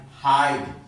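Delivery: हाइड said slowly with a long "ai" glide; slow model word. Shown as a demonstration. Teacher modelling हाइड slowly for students. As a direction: stretch the vowel in हाइड for the class. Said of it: "आई का हम चार प्रकार से उच्चारण".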